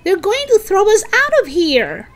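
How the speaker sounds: high, loud alarm; shouting. Acted. Melody warns friends about the vans, shouting. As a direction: call out the bad news in alarm. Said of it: "Melody shouted".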